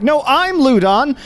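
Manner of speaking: high, insistent counter-claim; man outside house. Acted. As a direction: argue back that you are the real one. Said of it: "the Ludon you have met outside the house is like".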